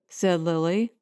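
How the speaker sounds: ordinary delivery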